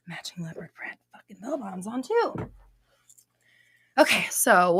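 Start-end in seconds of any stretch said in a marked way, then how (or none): 0.1-2.3 whispered aside. Real, not acted. Confessing outfit detail.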